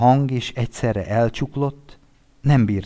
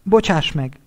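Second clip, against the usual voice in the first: high, faltering apology; halting apology; 8 semitones higher, 3 dB louder than usual. A narrator's reading. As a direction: stumble over the apology. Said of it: "akadozott a hang".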